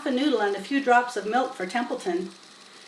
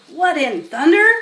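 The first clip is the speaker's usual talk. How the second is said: louder, higher startled exclamation; surprised; 6 semitones higher, 8 dB louder than usual. Acted. Farmhand shocked by the smell at the pen. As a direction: blurt it out in shock.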